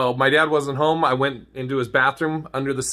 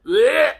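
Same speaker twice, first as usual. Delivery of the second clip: a higher retching blech of disgust; disgusted bleh; 10 semitones higher, 4 dB louder than usual. Retold or imitated. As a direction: spit out the taste. Reacting to the used toothbrush.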